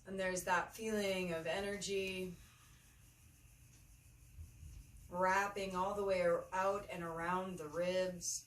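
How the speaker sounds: unhurried meditation guide, long pauses between phrases; measured, spacious yoga-teacher delivery; guided meditation. Acted. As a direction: lead them gently through the breath, phrase by phrase.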